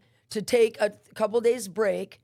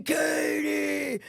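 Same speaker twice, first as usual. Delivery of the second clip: higher, drawn-out mock holler; imitating a long call down the hall; yelling a name; 5 semitones higher than usual. Woman escorted by a cop to the cells, yelling a name. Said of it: "yelling my name".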